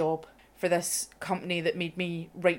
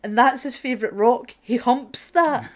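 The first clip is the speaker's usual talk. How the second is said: ordinary delivery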